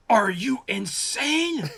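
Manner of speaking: very high, slow, loud astonished question; mock disbelief. Old master seeing the giant shark puppet, slow high disbelief. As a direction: gasp it out at the size of the shark. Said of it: "and he goes".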